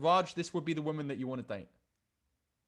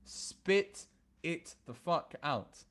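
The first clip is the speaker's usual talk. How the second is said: slow drawn-out demand; much slower than usual. Acted. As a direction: spit it the fuck out, stretched slow and pressing.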